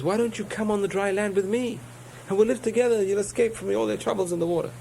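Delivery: high, coaxing fox; a high tempting fox offering escape. Acted. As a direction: pitch up and lure the fish sweetly. Fox tempts the fish ashore.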